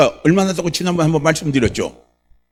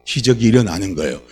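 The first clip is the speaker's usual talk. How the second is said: normal talking voice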